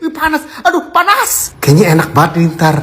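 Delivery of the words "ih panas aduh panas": high yelp at the heat; ouch it's hot. Real, not acted. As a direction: flinch at the heat. Touching hot food.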